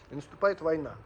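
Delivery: usual speaking voice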